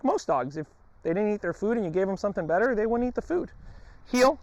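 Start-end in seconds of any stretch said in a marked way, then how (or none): 4.1-4.4 high, loud call to the dog; sharp command. Real, not acted. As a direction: snap the command out.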